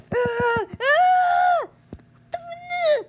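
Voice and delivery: high panicked shout; startled yelp. Real, not acted. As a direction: yelp in alarm. Sudden shock in game.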